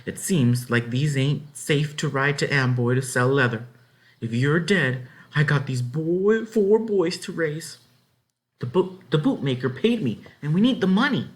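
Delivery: nagging wife; scolding. Acted. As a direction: berate her husband. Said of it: "She was scolding her husband".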